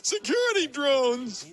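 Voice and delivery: high squeaky mock voice of a fleeing burglar; panicked burglar. Burglar fleeing the security drone, high mock panic. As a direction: squeal it like a spooked crook bolting for the door. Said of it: "and he goes".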